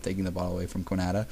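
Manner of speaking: usual speaking voice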